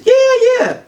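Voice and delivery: high, loud retold yeah-yeah; over-eager reassurance. He assures her he's only playing, over-eager. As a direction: squeak out an over-keen yes.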